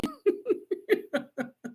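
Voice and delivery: a high, breathy burst of laughter at herself; embarrassed laugh. Real, not acted. Fumbling the word technologically, she laughs at herself. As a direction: laugh at your own tongue-tie.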